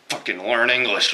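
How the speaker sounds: imitating someone irate; lightly acted anger; mock angry demand. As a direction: put on a touch of outrage. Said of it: "why are you so angry".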